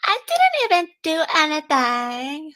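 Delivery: mimicking the woman's whine; imitating her voice. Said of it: "I'm trying to get into the, the voice and everything".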